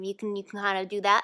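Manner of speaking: ordinary talk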